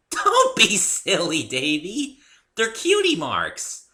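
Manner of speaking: slow, high, loud giggly delivery; giggly pony. A narrator's reading. Pinkie teasing the newcomer about cutie marks, giggly. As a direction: tease him sweetly. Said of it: "bursting into another giggle".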